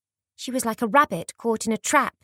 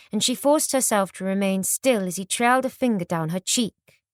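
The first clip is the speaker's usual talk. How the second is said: ordinary delivery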